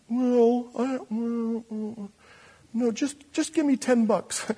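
man bargaining with God; hedging dodge. Acted. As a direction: squirm out of the offer.

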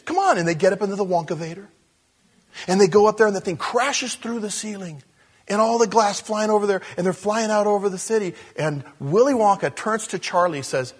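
high, loud breathless rush of wonder; emotional, excited retelling. Real, not acted. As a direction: tell the climax at full tilt, overwhelmed. Overwhelmed retelling of film's climax.

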